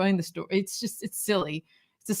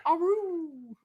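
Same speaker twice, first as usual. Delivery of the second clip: playful werewolf howl, pitched up; wolf howl; 8 semitones higher, 5 dB quieter than usual. Pretend. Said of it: "There's an Aru in here".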